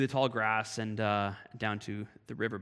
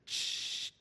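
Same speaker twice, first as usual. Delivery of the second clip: soft hissing shh like a rattlesnake; a snake's hiss in the tall grass; imitated snake hiss; 7 dB quieter than usual. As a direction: breathe out a quiet snake hiss. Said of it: "I hear this".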